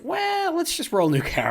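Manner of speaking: teasing, high and loud; fake-out, then laugh it off; mock serious joke. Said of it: "I'm kidding with you".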